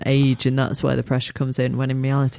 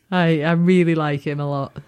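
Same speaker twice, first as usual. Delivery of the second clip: ordinary delivery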